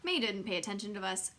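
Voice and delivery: plain reading voice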